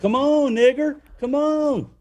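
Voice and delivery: high-pitched mock southern accent. Driver taunting from his car window, mock southern accent. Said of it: "that's what he was saying".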